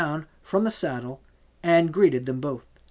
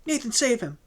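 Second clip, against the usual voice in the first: much higher, tearful plea; a weeping young woman begging, much higher; 8 semitones higher than usual. A narrator's reading. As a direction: beg through tears. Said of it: "she wept".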